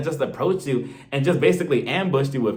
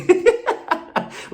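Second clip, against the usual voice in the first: breathy, higher laugh; airy laugh; 4 dB louder than usual. Real, not acted. Laughing at her own daughter impression. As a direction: burst into a silent laugh.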